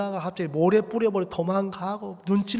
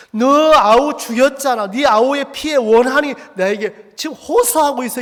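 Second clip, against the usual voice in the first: loud, raised-pitch accusation; angry rebuke; 5 semitones higher, 6 dB louder than usual. Acted. God confronts Cain over his brother's blood, in a loud rebuke. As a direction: thunder the charge at the culprit.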